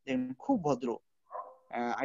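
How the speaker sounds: ordinary talk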